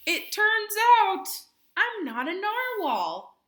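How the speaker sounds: little narwhal's big announcement; Kelp's confession. Acted. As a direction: blurt it out nervously excited. Kelp tells his news.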